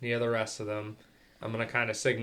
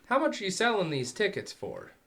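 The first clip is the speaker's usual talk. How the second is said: higher, louder friendly opener; casual bargaining; 8 semitones higher, 6 dB louder than usual. Acted. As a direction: sidle up and ask the price. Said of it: "go up and go".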